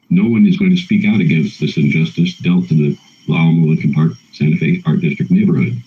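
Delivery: ordinary delivery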